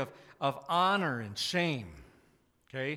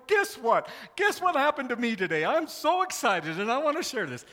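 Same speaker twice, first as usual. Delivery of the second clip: high, loud, gushing mock excitement; mock excited calling; 7 semitones higher, 6 dB louder than usual. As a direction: call it out like you can't contain the good news. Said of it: "leaping for joy, calling".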